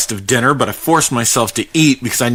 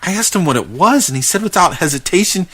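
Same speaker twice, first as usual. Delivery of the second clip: usual speaking voice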